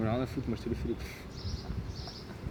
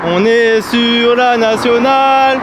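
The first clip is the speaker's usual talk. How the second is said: announcer voice; 9 dB louder, much slower than usual. Acted. Announcing the road, music behind.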